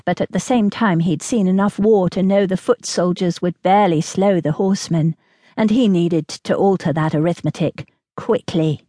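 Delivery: ordinary delivery, nothing marked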